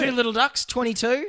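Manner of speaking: bingo hall number call; bingo caller. Acted. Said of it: "You could be an announcer".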